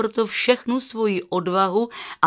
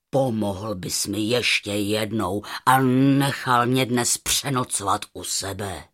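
low, slow lion voice; deep-voiced lion asking a favor; grateful lion; 7 semitones lower than usual. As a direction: speak deep as a big lion, savoring it.

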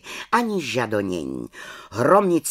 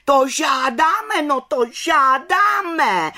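much higher, louder, slow chant; hollering in chorus; 14 semitones higher, 6 dB louder, slower than usual. Acted. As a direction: bellow it as a mob. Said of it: "hulákaly čarodějnice sborem".

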